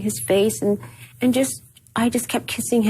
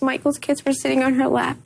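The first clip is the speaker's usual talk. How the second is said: ordinary talk, nothing marked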